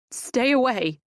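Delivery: shaky, scared plea; trembling. A narrator's reading. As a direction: voice quivering with fear. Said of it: "with a tremble in my voice".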